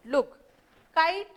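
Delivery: normal talking voice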